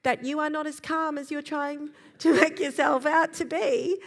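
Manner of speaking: put-on the child seeing through the act; kid calling it out. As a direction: kid telling parent they're not calm, with a laugh. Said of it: "What they're saying is".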